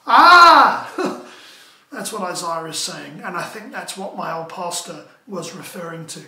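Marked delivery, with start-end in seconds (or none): 0.1-0.9 loud, high "Ah!"; sudden mock-terrified yell; acted cry of alarm. Preacher acting Isaiah overwhelmed by God, crying out.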